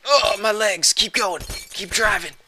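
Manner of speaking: high, loud anguished shout; mock pained cry. Hurt character shouting to keep driving, high pained cries. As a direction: yell it like a hurt man pushing on.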